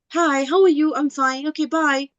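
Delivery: high, breezy mock small talk; perfunctory exchange. Shown as a demonstration. Teacher mimics a passing exchange, perfunctory and high. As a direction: rattle off hello and goodbye without caring. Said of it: "It will not just be the".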